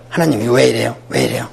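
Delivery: low, muttered mock complaint; arguing with God. Believer questioning God back and forth, half-voiced arguing. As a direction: grumble it softly, as if disputing. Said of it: "하나님한테 주고받고 따지는 거예요".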